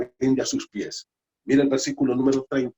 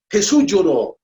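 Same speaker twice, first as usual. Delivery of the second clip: louder and higher, ringing out; proclaimed loudly; 7 semitones higher, 7 dB louder than usual. Real, not acted. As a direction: proclaim it to the whole church. Key verse said twice, hushed then loud.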